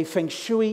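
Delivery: "feng shui" with SHOO-ee at the end; guest's "feng shui". Retold or imitated. Comedian imitating the guest's way of saying it, feng shooey. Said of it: "I'm sure you'd say".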